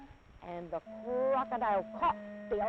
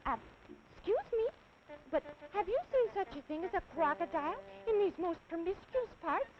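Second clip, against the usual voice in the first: put-on very high, small child voice; elephant's child; 9 semitones higher than usual. Little elephant politely asking the snake, high child voice.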